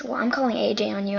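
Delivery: put-on fast, tattling threat from the girl; quick threat. Girl threatens to report her, quick tattling. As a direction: rattle it off like a tattletale. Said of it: "And she goes".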